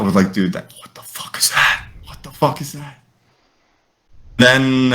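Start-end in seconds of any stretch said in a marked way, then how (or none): 0.3-3.0 hushed, whispery mock panic; freaked-out hush. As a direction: whisper it like a scared friend. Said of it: "We freak the fuck".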